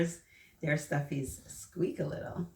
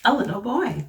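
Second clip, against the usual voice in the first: lively, louder picture-book storytelling voice; reading to children; 6 dB louder than usual. Acted. Teacher reading a picture book aloud to the children, lively storyteller voice. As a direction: read it out big and bright for the little ones.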